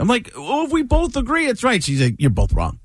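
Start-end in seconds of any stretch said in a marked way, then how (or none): 0.4-1.8 high-pitched husband making his case; mock protest. Acted argument with wife. 2.2-2.8 low-pitched, dismissive wife's ruling; mock flat verdict. Wife's deadpan verdict, voiced.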